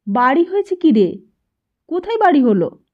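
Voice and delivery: as if the sky fell on her; astonished mother. A narrator's reading. Mother hearing news from her son, astonished. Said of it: "মা যেন আকাশ থেকে পড়ল".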